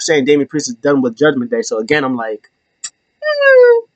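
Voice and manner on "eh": high loud dismissive eh; a shrugging high eh. Real, not acted. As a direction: squeak out a doubtful eh. Shrug noise at rumor.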